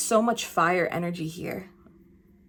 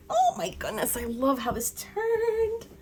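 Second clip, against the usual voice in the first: delighted surprise. Real, not acted. Surprise at a fallen card.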